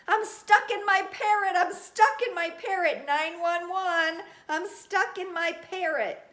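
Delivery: very high, loud panicked yelling; shrieking I'm stuck, high and loud; panicked shout. Acted. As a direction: scream for help in a panic. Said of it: "I panicked and shouted".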